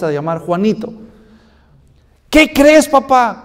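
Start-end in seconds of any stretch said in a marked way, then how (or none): 2.3-3.4 put-on high, eager child calling his dad; a small boy bursting with news for his papá; excited little boy. Niño emocionado llamando a su papá.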